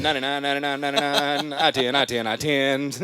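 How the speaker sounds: high, loud rattled-off numbers; auctioneer. Retold or imitated. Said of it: "legitimately sound like an auctioneer".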